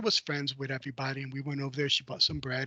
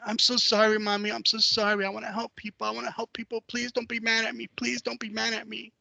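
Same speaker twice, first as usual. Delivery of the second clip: higher, pleading child's sorry, lightly acted; mock crying apology; 6 semitones higher than usual. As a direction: beg forgiveness like a tearful little boy, understated. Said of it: "I just started crying".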